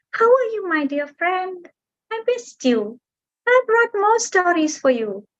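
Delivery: high, happy little bird; delighted bird greeting. Acted. Bird greeting the mountain after a year, high and delighted. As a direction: greet an old friend with joy.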